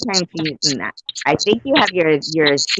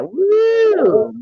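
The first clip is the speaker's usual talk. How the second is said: cheering; 4 dB louder than usual. Real, not acted. Game host cheers correct answer.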